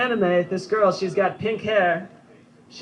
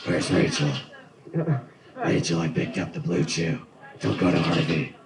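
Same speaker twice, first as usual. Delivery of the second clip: much lower, growled gravel; Batman voice; 10 semitones lower than usual. Retold or imitated. Batman growling about Rachel, low gravel voice. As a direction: rasp it like the caped crusader. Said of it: "He sounds a lot like my Batman".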